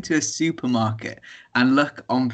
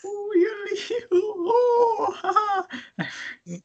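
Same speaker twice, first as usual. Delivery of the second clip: high, breaking laughing yodel; a wild yodel that collapses into laughter; yodel attempt. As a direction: yodel squeal that dissolves into a laugh. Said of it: "Does that surprise?".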